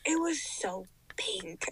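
slow, hushed whisper; horrified whisper. Retold or imitated. Confiding a shocking detail to co-host, horrified whisper. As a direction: whisper it slowly in mock horror.

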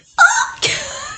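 high squealing laugh; delighted squeal of laughter. Real, not acted. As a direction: burst into a shrill giggle. Laughs at iconic book line.

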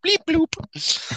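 very high squeaky robot bloop; toy robot voice. Pretend. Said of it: "Robot noises".

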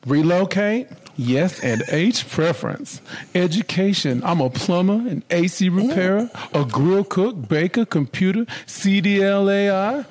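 high, laughing listing of jobs; amused reading. Acted. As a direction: read the list cracking up. Reading profile aloud, laughing.

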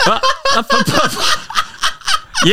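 high, spluttering fake laugh; put-on laugh. Friend mocking Amy's accent, put-on laughter. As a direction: force out a high stage laugh. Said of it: "we were trying to put on a laugh".

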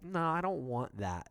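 high, slowed mock refusal; drawn-out, obstinate retold no; stubborn refusal. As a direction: imitate a customer digging his heels in. Stubborn customer refuses in retelling.

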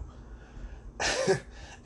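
a short airy laugh at his own joke; breathy laugh. Real, not acted. Amused at his own shawarma joke, short airy laugh. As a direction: let out a quiet breathy chuckle.

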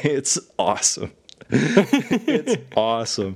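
real laughter breaking into the words; amused, chuckling between phrases; laughing. Real, not acted. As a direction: laugh through it, delighted. Laughing after own whiny impression.

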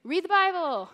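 loud, high nagging repetition; mock repeated command. New believers hearing only one command, loud mock nagging. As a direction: hammer it like a pushy preacher. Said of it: "if all you hear is".

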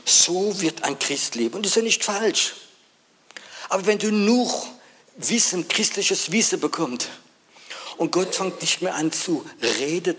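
high, loud preaching burst; preaching at full force. Acted. Preacher warning the congregation, loud and high. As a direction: hammer the point home.

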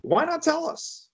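high, slow disbelief; why not tell us, high and drawn out; incredulous. Real, not acted. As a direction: ask it in stunned disbelief. Repeating the borrower's silence, incredulous.

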